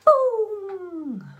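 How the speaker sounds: high, loud punchy boom; dramatic boom. Real, not acted. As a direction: slam it down like a drumbeat. Dramatic reveal of number one tip.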